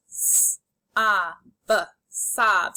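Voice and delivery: "S, a, b" as separate sounds, then "sob" whole; sounded out, blended. Shown as a demonstration. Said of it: "say the sounds and then blend".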